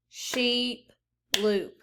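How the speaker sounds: sheep and loop apart, one beat each; clapped syllables. Shown as a demonstration. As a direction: clap out sheep, then loop. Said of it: "Now clap it".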